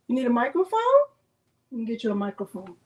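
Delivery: ordinary talk, nothing marked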